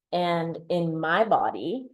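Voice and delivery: ordinary talk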